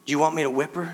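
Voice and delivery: low, quiet mock-serious question; mock serious grandpa. Grandpa asking little Lennon, mock serious and hushed. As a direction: play it stern for a little granddaughter. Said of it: "I looked at her real serious and said".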